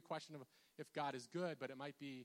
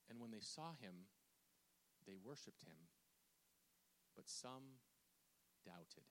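deliberate scripture reading; 2 semitones lower than usual. Acted. Preacher reads key verse to congregation, slowing into softness. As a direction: let each phrase hang, sinking to a hush.

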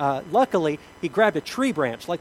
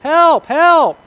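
high desperate yell for help; shouting for help; 12 semitones higher, 14 dB louder than usual. Acted. Man hanging off a cliff shouts for help, desperate yell. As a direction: cry out for rescue from the cliff.

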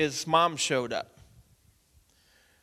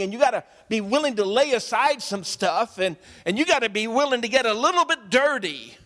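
louder, higher, urgent sermon delivery; full-force preaching; 6 semitones higher, 6 dB louder than usual. Acted. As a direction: drive it home to the congregation.

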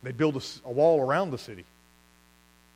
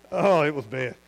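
ordinary talk

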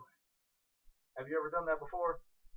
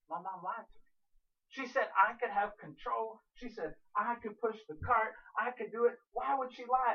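acted childish protest, pitched up; upset kid; 6 semitones higher than usual. Boy whose mom took back control, upset. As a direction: complain like a betrayed child. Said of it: "was so upset".

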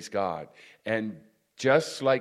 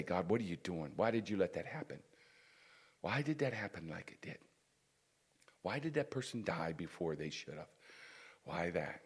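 softer, plaintive run of why questions; complaining to God; 5 semitones lower, 10 dB quieter than usual. Retold or imitated. Preacher mimics a believer complaining to God, softly whining. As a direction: whine the questions quietly, half to yourself. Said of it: "go to God and complain".

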